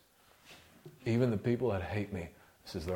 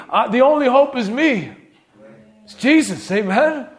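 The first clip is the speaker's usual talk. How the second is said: loud, climactic preacher's cry; preaching at full force; 7 semitones higher, 10 dB louder than usual. Acted. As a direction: build to a triumphant, shouted altar call. Sermon climax.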